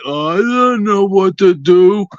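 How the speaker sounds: low, slow, lightly mocking whine; mock clueless player. Caller mocking players who freeze on the field, low and slow. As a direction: mimic a lost player, drawn out. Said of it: "They're just going".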